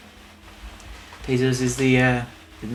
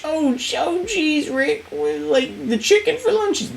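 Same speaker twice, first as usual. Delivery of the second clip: put-on much higher, worried stammering boy; high, jittery cartoon kid; Morty. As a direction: stutter out an anxious excuse. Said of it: "Morty voice again".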